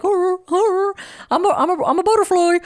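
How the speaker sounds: silly high mocking voice; mock airy self-talk. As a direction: play a dizzy, fluttery optimist for laughs. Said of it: "We're not just like".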